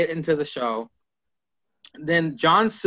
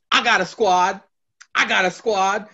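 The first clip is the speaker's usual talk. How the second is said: put-on forced young lingo; 5 semitones higher, 4 dB louder than usual. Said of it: "I know the young lingo".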